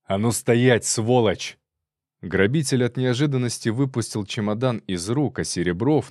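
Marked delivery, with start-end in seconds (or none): 0.0-1.6 loud, angry order to halt; sharp loud challenge; commanding shout. Acted. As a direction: yell at a fleeing thief to stop. Chasing a robber, calling out.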